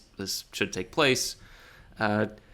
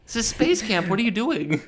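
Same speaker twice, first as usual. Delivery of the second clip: incredulous; 10 semitones higher than usual. Acted. Scolding the masked forest guy.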